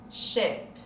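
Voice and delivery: "ship" said softly with a short i; whisper-soft "ship" on a short vowel; soft model word. Shown as a demonstration. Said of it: "som de sh". ("ship" suave, com I curto)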